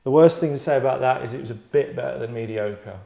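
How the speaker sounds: ordinary talk, nothing marked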